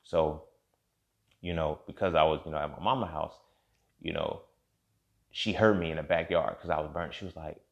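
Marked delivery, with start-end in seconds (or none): none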